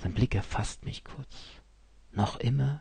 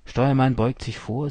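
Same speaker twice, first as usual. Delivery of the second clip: ordinary delivery, nothing marked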